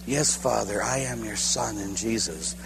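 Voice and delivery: low, soft answer to God; reply softly, fully trusting; hushed surrender. Retold or imitated.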